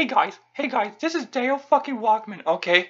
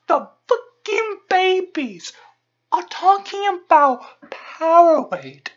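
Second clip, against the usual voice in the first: slowed, louder, dragging each word; slow outraged emphasis; 4 semitones higher, 3 dB louder, slower than usual. Acted. Ranter stressing the babies and Powerade, slow and loud. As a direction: spell out the outrage slowly.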